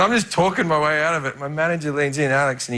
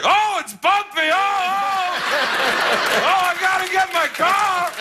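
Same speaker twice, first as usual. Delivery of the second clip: Al Pacino on a golf cart; 14 semitones higher, slower than usual. Retold or imitated. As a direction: bounce down the hill like a hammed-up Pacino. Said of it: "like watching a sketch of Al Pacino".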